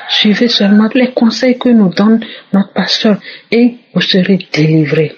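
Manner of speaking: ordinary talk, nothing marked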